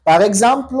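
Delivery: ordinary talk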